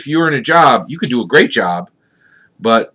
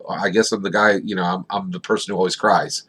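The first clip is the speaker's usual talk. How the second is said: mock tearful; 5 semitones lower, 7 dB quieter than usual. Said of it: "he said".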